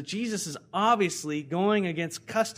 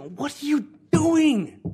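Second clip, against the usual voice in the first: high, loud, stretched-out disbelief; shouted, scandalized question; incredulous outcry; 11 semitones higher, 9 dB louder, slower than usual. Acted. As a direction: cry out in mock outrage at the choice. Acts out people's outraged reaction.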